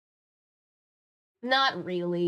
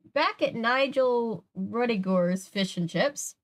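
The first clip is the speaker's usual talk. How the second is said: slow, higher storybook reading; story narration; 4 semitones higher, 3 dB louder, slower than usual. A narrator's reading. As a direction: set the scene slowly for listeners. Reads story aloud.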